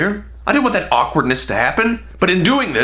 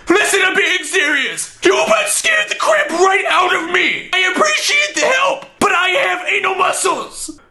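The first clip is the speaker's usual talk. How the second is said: scared stranger; 14 semitones higher than usual. Retold or imitated. Man in the next stall calls out, panicked high shout. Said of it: "All I hear is".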